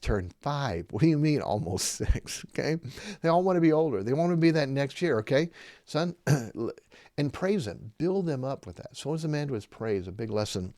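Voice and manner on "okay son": usual speaking voice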